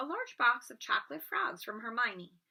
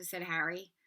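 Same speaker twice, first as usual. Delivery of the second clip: usual speaking voice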